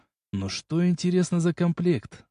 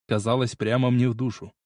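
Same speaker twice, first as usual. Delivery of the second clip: ordinary delivery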